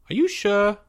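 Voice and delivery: high, uncertain questioning voice; nervous boy. A narrator's reading. Sweating boy doubting Leo's plan, nervous. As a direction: ask it in a small, high worried voice.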